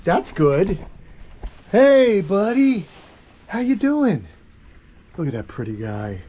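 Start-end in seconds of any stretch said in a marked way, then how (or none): 1.7-4.3 high, bright pet voice, louder; pet-talk greeting. Real, not acted. Keeper greeting his monitor lizard in high pet talk. 5.2-6.2 soft admiring. Real, not acted. Keeper softly admiring his lizard, low and fond.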